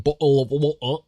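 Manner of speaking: mock British "bottle of water" with glottal stops for the t's; imitated English accent. Americans mocking the English, exaggerated British "bottle of water". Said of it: "make fun of the English for saying".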